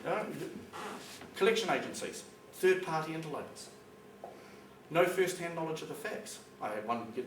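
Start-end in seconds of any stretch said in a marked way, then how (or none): none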